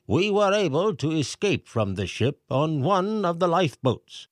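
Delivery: high, pompous mayor voice reading aloud; mayor reading letter. A narrator's reading. Mayor reads the pelican's message to the crowd, pompous. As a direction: read the message like a fussy mayor.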